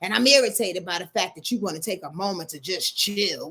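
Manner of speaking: ordinary talk, nothing marked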